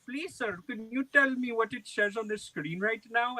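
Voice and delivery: high, put-on pleading voice; imitated pleading scammer. Imitates pleading scam caller.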